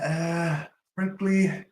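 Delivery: very high, mocking frankly; Trump impression. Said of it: "He's like".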